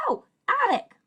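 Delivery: high "oh" of discovery; as if the word has just clicked; sudden recognition. Pretend. Pretends to recognise the blended word.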